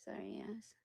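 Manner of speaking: quick unvoiced aside; hushed sorry to someone nearby; whisper. Real, not acted. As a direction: whisper an apology off to the side.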